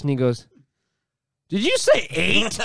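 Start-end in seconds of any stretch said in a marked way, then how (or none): 1.5-2.6 high, slow, disbelieving question; stretched-out mock disbelief, pitched up; incredulous order taker. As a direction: imitate a baffled server double-checking the order.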